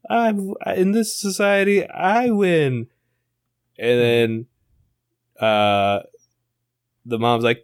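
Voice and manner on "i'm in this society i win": put-on high, playful little boy voice; a kid smashing toy villains, shouting along; kid hero voice. Boy playing with plastic toys in the cellar, high kid voice.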